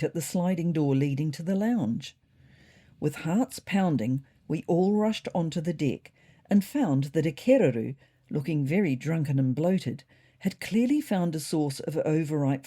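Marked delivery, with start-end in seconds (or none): none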